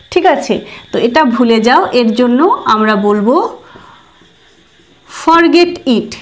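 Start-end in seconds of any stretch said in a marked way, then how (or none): none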